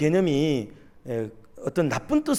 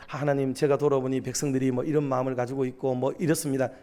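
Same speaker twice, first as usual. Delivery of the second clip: lower, softer, respectful address to God; polite, deferential report; 4 semitones lower, 4 dB quieter than usual. Acted. Prophet reporting the people's mind to God, politely. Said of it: "뭐 공손하게".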